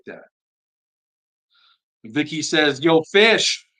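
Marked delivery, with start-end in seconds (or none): 2.8-3.7 a playful Long Island "Yo, Fish", loud and high; Long Island accent. Retold or imitated. Host reading a viewer's greeting in a mock Long Island accent. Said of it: "in a Long Island accent, maybe".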